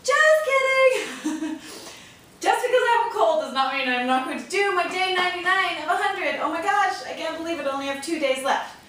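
usual speaking voice

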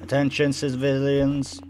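stretched public-address call; radio announcer. Acted. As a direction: call it out like an emergency broadcast. Reads radio broadcast in game.

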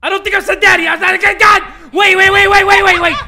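high, loud frantic yelling; panicked shouting. Real, not acted. Player under attack by the girl, panicked shouting.

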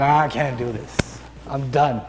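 slow, low, worn-out quitter; giving up. Acted. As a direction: give up on it, slowly and low. Acting a trainee giving up.

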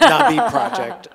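high laughing words, slowed. Real, not acted. Host laughing as he jokes about cancelling the Nabi project. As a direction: laugh through the name.

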